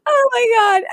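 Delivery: high squeal of delight; a sudden high burst of glee. Real, not acted. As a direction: squeal it, tickled by the scene. Delighted at the scene.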